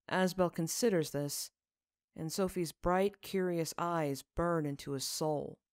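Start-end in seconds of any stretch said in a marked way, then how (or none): none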